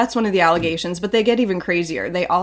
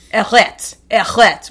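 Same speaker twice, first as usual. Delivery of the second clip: French people cutting off an American's French, loud mock protest; louder, higher French "stop"; mock French objection; 3 semitones higher, 7 dB louder, slower than usual. Mock French people rebuffing an American's French, indignant. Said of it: "French people hate Americans speaking French".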